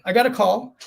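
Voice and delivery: ordinary delivery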